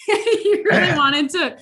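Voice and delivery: laughing through the words, slowed down. Real, not acted. Host teasing the guest about wanting to make it right, laughing. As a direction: tease him gently while laughing.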